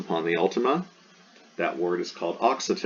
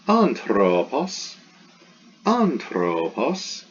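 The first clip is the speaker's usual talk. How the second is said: "Anthropos" slow, with a high first syllable; careful teacher's demo of "Anthropos", accent on AN; acute on the antepenult; 4 semitones higher, much slower than usual. Shown as a demonstration. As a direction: draw out "Anthropos", pitch jumping up on AN. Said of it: "the antepenult".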